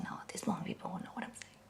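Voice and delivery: barely voiced, fast mumble; mumbled whisper. Shown as a demonstration. Teacher showing how a mumble sounds. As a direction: mumble it under your breath. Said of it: "Like this".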